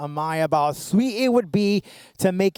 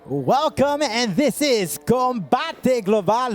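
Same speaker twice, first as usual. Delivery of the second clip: announcer hype; 5 semitones higher, slower than usual. Acted.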